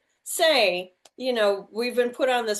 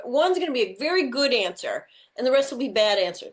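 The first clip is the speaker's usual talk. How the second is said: ordinary talk, nothing marked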